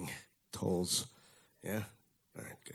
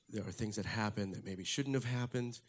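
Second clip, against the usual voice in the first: normal talking voice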